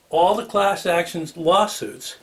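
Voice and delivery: ordinary talk, nothing marked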